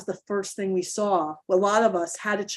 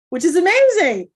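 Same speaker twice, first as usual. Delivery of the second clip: sudden, higher and louder burst of delight; light up on amazing, a real thrill; excited burst; 7 semitones higher, 8 dB louder than usual. Real, not acted. Coach to parents, thrilled: higher, louder burst of excitement. Coach exclaims about mindset shift.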